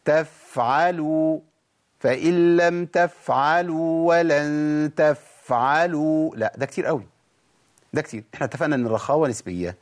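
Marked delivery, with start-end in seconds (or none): 0.0-6.4 تفعلوا with the f hissed far too long; overlong f. Shown as a demonstration. Teacher mocking learners who stretch the f too long. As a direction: drag out the f in تفعلوا. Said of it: "يقول لك إيه".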